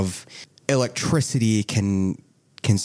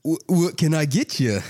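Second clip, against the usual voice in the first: higher-pitched storekeeper character voice; halting, raised-pitch shopkeeper greeting; 7 semitones higher than usual. Acted. Game master as shopkeeper answering a customer, in raised character voice. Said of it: "where's the shopkeeper".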